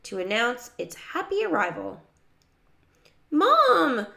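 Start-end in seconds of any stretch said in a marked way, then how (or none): 3.3-4.1 high, loud yell for Mom. A narrator's reading. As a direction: call out at full voice. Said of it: "yelled at the top of their lungs".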